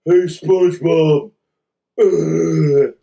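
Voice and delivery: loud, lightly voiced dopey sidekick; so-so dopey cartoon voice; Patrick. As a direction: call out as a dim cartoon pal, then groan. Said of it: "My Patrick voice is kinda".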